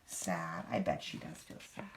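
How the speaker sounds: very low, hushed and sorry; sympathetic sadness. Acted. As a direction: drop right down in soft sympathy. Sympathising with sad character.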